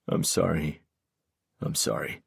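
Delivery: soft, low, remorseful repeating; hushed, falling-away apology; repeated apology. A narrator's reading. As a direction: apologise helplessly, voice dropping. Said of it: "he kept repeating".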